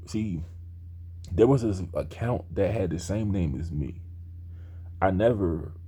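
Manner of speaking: ordinary talk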